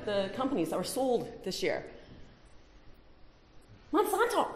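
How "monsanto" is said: high, incredulous exclamation of a name; mock outrage. Real, not acted. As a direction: spit out the name like the ultimate villain. Naming a hated company.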